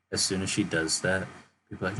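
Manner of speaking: ordinary talk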